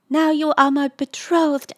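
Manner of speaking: high, loud pronouncement; ardent declaration. A narrator's reading. Lisa quoting his betrothal, high ardent declaration.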